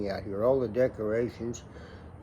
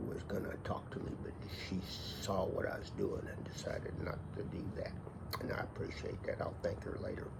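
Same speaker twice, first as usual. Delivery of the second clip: hushed; 4 semitones lower, 12 dB quieter, faster than usual. Real, not acted. Wife peeking out the door.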